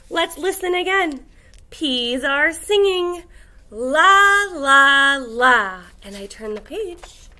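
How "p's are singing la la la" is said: lively, sing-song picture book read; reading to children. Acted. Teacher reading a counting book to kindergartners, animated. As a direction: read it like storytime for little kids. Rereads the rhyme.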